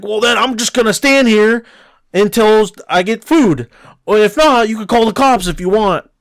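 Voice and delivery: hammed-up stubborn customer; defiant customer. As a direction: dare them to call the cops. Customer defying manager.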